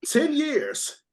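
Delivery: high, giggling repetition; laughing. Real, not acted. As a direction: crack up remembering it. Laughing about the memory.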